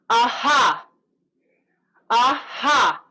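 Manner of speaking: loud breathy two-part aha; vocal warm-up aha with force; projected aha. Shown as a demonstration. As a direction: send it out with a burst on the ha. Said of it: "send it out".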